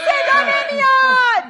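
mock shout. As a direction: pretend you can't hear and yell. Host teasing for louder.

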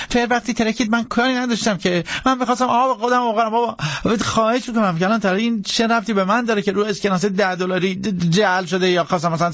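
high, hurried, stammering protest; frantic pleading. Acted. Jackson begging the marshal to believe him, frantic pleading. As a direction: beg up high, words tumbling out fast. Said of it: "بنا کرد به التماس کردن".

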